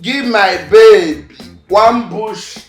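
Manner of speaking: usual speaking voice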